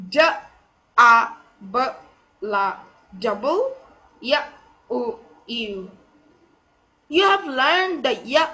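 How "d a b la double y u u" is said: "double U" built sound by sound with pauses; phonetic sounding-out. Shown as a demonstration. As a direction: isolate D, A, B, La, then blend "double". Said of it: "So it will be primary stress".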